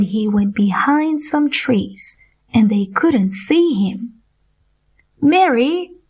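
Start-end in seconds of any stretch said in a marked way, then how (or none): 5.2-6.0 a raised, higher call of her name. A narrator's reading. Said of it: "Peter cried".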